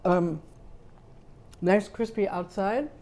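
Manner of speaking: ordinary talk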